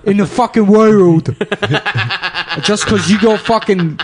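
Snatch Adams. Acted. British comic playing a foul doctor to a sick kid, high hammed-up abuse. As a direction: berate a sick child in a hammed-up English accent. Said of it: "I'm Snatch Adams".

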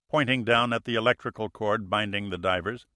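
usual speaking voice

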